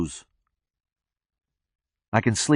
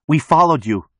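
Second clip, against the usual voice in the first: higher, louder acted yell; 5 semitones higher, 5 dB louder than usual. A narrator's reading. Marla calls to the man with the gun, raised-voice yell. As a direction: call out to him, pitched up. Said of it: "Marla yells".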